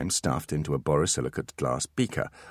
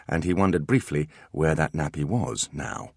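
ordinary delivery

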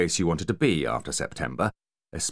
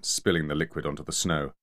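usual speaking voice

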